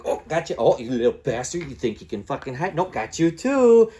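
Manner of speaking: cocky mock taunt to opponents; playful taunting.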